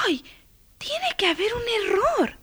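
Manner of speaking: put-on very high girl's voice, taken aback; dismayed exclamation. A narrator's reading. Girl opens the box of paints, high surprised exclamation. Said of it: "exclamó".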